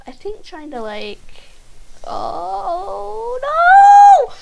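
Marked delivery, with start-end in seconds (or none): 2.0-4.3 rising to a loud, very high scream; wailing dismay. Real, not acted. Player failing mid-flight, wailing dismay. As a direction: wail up into a shriek.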